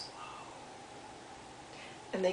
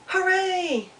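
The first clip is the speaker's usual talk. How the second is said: echoed shout; 9 semitones higher than usual. A narrator's reading. The echo answering Little Beaver from across the pond, high cheer. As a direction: call it back like a distant echo. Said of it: "guess what he heard".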